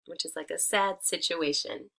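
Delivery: plain reading voice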